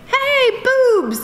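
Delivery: very high, singsong call; gleeful announcement. Acted. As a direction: announce it with silly glee. Acts out gleeful casting call.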